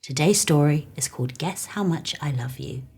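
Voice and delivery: plain reading voice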